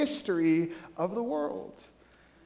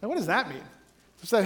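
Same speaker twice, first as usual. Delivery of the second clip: usual speaking voice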